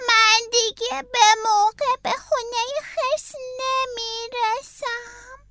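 the snail crying that it will be late. Acted. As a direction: whimper it like a sad little snail. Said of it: "حلزون با گریه گفت".

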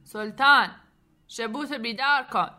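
loud call, name first, then the order; captain's shout. A narrator's reading. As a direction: holler down to the engine room. (داد زدن ناخدا)

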